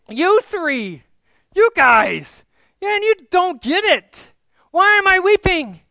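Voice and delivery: loud, high, frustrated outburst; exasperated shout. Acted. Mary berates the three at the tomb, shouting in exasperation. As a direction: shout at them for not getting it.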